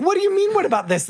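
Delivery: very high mock squeak that drops back. Husband mocked echoing his wife, high squeak. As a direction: start way up, fall back down. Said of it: "And he's like".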